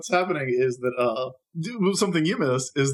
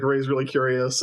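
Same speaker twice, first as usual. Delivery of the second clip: ordinary talk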